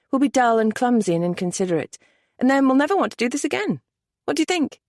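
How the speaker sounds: quick, rising playful tease; playful excited. A narrator's reading. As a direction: speed up, climbing with mischief.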